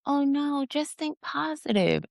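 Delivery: high, slow mock-soothing voice; toxic positivity. Spiritual community answering someone who is angry, mocking toxic positivity. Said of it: "the toxic positivity comes in".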